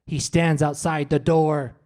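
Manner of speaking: mock horror-film sleep-talk; imitating sleep-talking wife. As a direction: deliver a creepy warning from sleep, slowly. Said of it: "looked me dead in the eyes".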